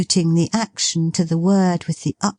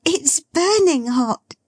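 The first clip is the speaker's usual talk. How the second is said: excited cry; 12 semitones higher than usual. Acted. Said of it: "cried Meg".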